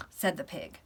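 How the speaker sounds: ordinary delivery, nothing marked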